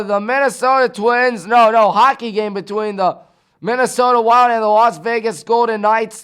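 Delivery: ordinary delivery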